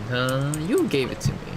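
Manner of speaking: ordinary talk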